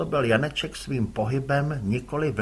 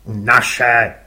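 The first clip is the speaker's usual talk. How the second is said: louder gruff curt reply; gruff father; 4 semitones lower, 10 dB louder than usual. Acted. Father answers his son curtly, gruff.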